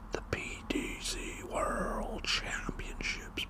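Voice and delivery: slow, drawn-out whispered announcement; whispered hype. Acted. Darts fan hyping tonight's championship, whispered hype. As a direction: stretch WORLD out like a whispered announcer.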